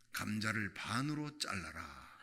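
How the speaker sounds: low, slow voice of God; inner divine voice. Retold or imitated. Voice of God in her heart, low and slow. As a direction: intone it low and slow like a voice from within.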